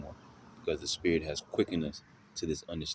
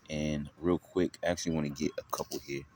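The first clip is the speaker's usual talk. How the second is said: ordinary talk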